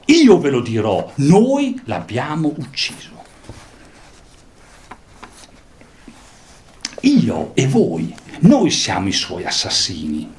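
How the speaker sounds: loud, high declaiming of the madman's speech; dramatic reading. Acted. A teacher reading the madman's speech to the class, declaiming. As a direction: read it like a prophet's outcry.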